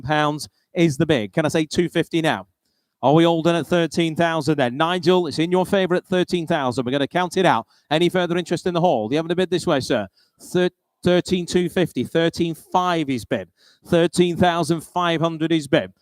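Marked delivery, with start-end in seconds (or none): none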